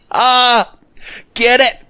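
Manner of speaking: high, loud combat cry; excited yell. Real, not acted. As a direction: shout it out high mid-fight. Exclaims during in-game fight.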